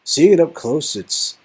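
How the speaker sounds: earnest young man in awe, lightly voiced; a man lost in wonder before a painting; [name] admiring painting.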